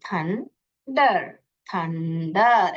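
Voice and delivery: थंडर drawn out slowly, part by part, then joined; blended word demo. Shown as a demonstration. Teacher showing class how to read thunder, slow blending. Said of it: "ऐसा पढ़ने का".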